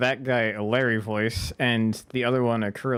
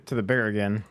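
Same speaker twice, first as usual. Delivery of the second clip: ordinary talk, nothing marked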